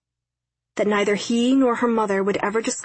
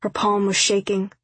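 plain reading voice

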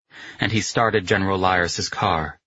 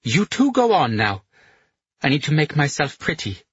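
tipsy woman voice; 8 semitones higher than usual. A narrator's reading. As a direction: shoo them off, a little drunk. Tipsy Dolly dismissing them.

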